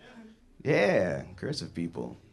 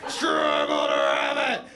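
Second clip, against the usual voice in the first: very deep, drawn-out bellow; monstrous low gloat; gloating roar; 11 semitones lower, 6 dB louder, slower than usual. Acted. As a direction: roar it low and slow in mock triumph. Gloating climax of burrito rant.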